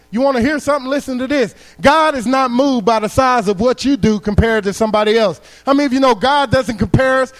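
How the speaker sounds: high, loud sermon drive; preaching at full force. Acted. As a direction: hammer it home to the crowd. Preacher climbs again.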